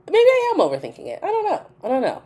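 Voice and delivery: high, fast, squeaky shrug; high-pitched I don't know; high unsure. Real, not acted. Squeaky self-doubt.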